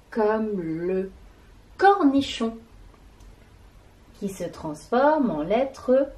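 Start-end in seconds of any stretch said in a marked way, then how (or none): none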